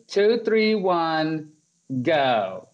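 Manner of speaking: nervously formal countdown. Retold or imitated. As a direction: imitate a nervous friend trying to sound official. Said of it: "I'm imitating her".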